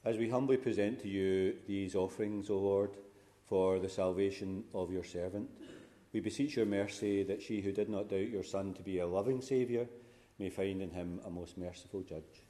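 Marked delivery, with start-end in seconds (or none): none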